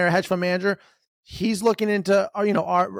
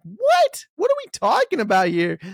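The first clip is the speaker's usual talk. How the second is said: much higher, louder disbelieving what; stunned what; 6 semitones higher, 3 dB louder than usual. Real, not acted. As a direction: yelp it out in disbelief. Stunned reaction to absurd slogan.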